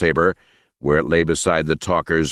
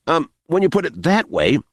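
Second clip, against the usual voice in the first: nervous high voice; 9 semitones higher than usual. Acted. Smiley voiced under pressure.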